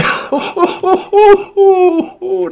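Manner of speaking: very high, loud giddy laugh; shrieking laugh. Real, not acted. Gamer laughs wildly after the lucky roll. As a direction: let out a squealing laugh of joy.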